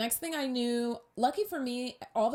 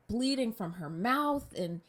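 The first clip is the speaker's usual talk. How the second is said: ordinary talk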